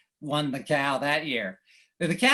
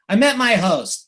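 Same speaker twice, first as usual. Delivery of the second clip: ordinary talk, nothing marked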